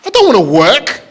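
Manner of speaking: loud mock horror about getting the job; loud dismay. Punchline reaction, loud.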